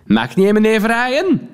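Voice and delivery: mocking woman. Woman jabs at the actor with a wrong name, mocking. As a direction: get his name wrong on purpose.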